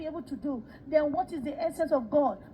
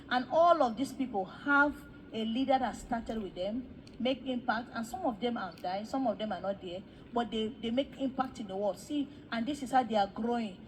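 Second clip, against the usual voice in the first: normal talking voice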